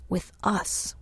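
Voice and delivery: hushed, breathless echo of her words; whisper. Real, not acted. Visitors echoing Sylvie's words to each other, whispered. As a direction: whisper it back as if puzzling.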